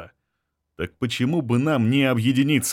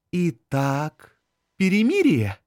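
woman's voice; 5 semitones higher, slower than usual. Acted. Anzhela's hopeful question.

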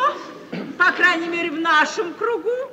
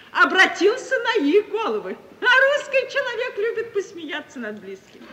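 higher, laughing through the words; laughing gloat; 7 semitones higher than usual. Acted. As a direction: gloat with a laugh over their disgrace. Gloating over rivals' public shame. (злорадно со смехом)

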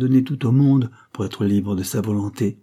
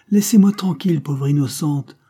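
higher, louder, cutting her off brusquely; brusque outburst; 6 semitones higher, 5 dB louder than usual. A narrator's reading. The old marquis cuts off his niece with a loud brusque outburst. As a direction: snap at her to leave him alone, raised and sharp. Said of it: "interrompit brusquement le vieux gentilhomme".

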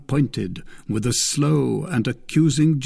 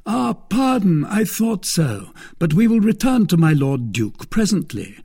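higher, mocking lord; a smug, teasing brother-in-law; Lord de Winter; 6 semitones higher, 3 dB louder than usual. Lord de Winter toying with Milady, higher voice.

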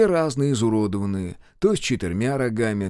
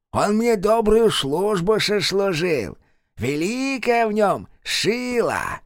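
high, slow, otherworldly drawl; eerie possessed man; 10 semitones higher, slower than usual. Acted. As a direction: sneer it like a possessed servant of a witch. Kravchuk's voice.

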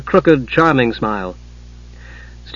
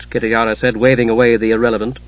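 usual speaking voice

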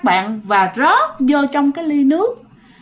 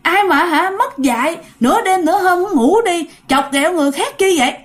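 sharp, higher scolding of a prankster; angry scolding; 5 semitones higher than usual. Acted. Sister-in-law scolding an unseen prankster, angry. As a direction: snap at whoever is teasing her at midnight. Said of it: "bà đã chửi, bà đã la".

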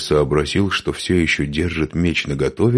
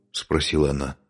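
usual speaking voice

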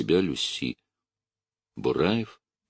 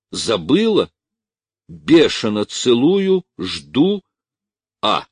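raised, slow, word-by-word reading; monotone, chopping words; 6 semitones higher, 7 dB louder, slower than usual. A narrator's reading. Officer reads the lover's telegram aloud, chopping words. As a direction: chop out each phrase of the letter. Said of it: "читал однотонно, рубя слова".

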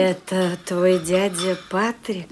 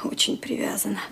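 usual speaking voice

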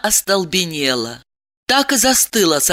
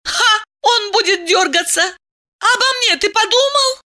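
high-pitched scoffing woman; indignant. Acted. Woman asks if lover thought of her, indignant. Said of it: "возмутилась Диана".

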